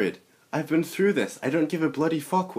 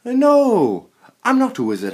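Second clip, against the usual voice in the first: shouting; 6 semitones higher, 7 dB louder than usual. Acted. Harry shouts denial.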